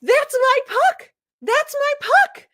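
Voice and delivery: high, loud furious yell over the puck; angry yell. Retold or imitated. Retelling yelling at a man who took her puck, high and loud. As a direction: yell it like someone stole your prize.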